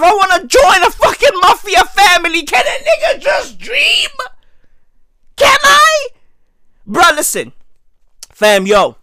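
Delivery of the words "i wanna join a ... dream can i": high-pitched mock-indignant plea; plead, climbing high on the last words; exasperated pleading. Comic pleading to join a mafia family, exasperated.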